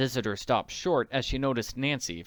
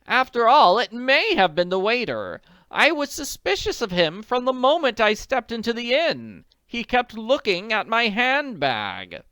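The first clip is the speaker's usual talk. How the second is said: distressed widow; 11 semitones higher, 8 dB louder than usual. A narrator's reading. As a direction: plead like an anxious society lady. Visitor voiced.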